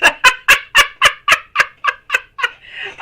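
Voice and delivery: long high squealing laugh of delight. Real, not acted. Reader laughing at a viewer's burst of excitement, high squealing laugh. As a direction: let a high giggle bubble over.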